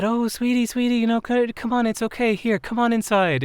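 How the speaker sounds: high, soothing imitation of a kindly woman; retold comforting woman. Woman comforts sobbing driver.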